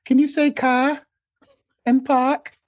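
very high mimicking voice; high-pitched mock pleading for the accent; cutesy outsider request. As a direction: imitate someone cooing over a cute accent. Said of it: "people think that's the cutest thing".

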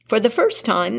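plain reading voice

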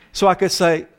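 normal talking voice

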